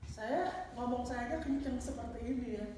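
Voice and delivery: ordinary talk, nothing marked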